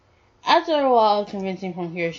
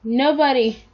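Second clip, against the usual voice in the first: mild, high-pitched yell; 6 semitones higher, 3 dB louder than usual. A narrator's reading. Answering who said that, a mild acted yell. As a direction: call it back, pitched up. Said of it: "he yelled".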